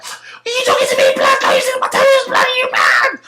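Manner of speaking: high falsetto threat; hammed-up shrieking road-man; shrieking roadman. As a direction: squeal the threat way up high and loud. The stabber shrieking again.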